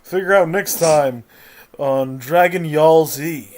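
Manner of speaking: loud dramatic episode-ending announcer; anime narrator. Acted. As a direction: hype the next-episode cliffhanger.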